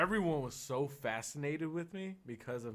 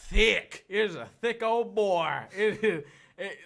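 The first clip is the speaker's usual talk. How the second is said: thick country accent; 8 semitones higher, 11 dB louder, slower than usual. Shown as a demonstration. A country boy shows his thick Oklahoma accent, loud. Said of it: "your accent comes out thick".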